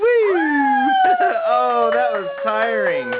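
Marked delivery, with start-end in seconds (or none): 0.0-1.4 squealing whoop into a laugh; high whoop and laugh. Real, not acted.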